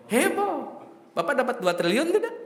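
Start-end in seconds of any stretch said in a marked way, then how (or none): 0.1-0.8 high-pitched comic exclamation; playful hype. Shown as a demonstration. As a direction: pitch it right up for mock fuss. Comic catchphrase, stirring up a fuss.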